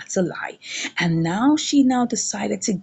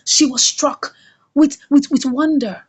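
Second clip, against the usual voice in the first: ordinary talk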